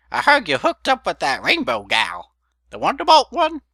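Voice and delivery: put-on high, loud cackly old mare; old granny voice. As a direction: play a nosy old grandmother. Old mare Granny Smith quoted.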